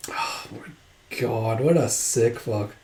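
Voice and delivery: soft, slow, breathless disbelief; a hushed real aside of horror; shocked whisper. Real, not acted. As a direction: murmur it to yourself, appalled. Own shocked reaction to manga.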